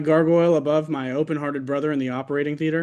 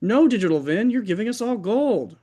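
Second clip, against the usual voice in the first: gushing surgeon; 7 semitones higher than usual. Acted.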